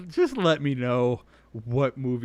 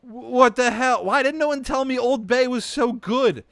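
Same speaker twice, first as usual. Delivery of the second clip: much higher and louder, amazed; excited outburst; 11 semitones higher, 9 dB louder than usual. Real, not acted. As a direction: burst out in delighted disbelief. Excited outburst over Old Bay.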